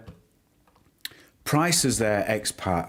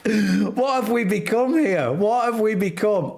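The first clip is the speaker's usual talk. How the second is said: higher, theatrical dismay; mock despair; 7 semitones higher than usual. Streamer reacting to filthy chat, mock despair. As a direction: wail it like a scandalised aunt.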